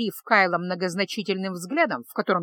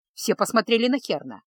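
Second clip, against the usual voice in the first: ordinary delivery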